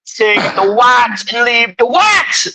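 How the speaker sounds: high, slow, shouted Jamaican sing-song; mock Jamaican shout. Shouting the line again in a mock Jamaican chant. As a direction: belt it like a dancehall call. Repeats louder.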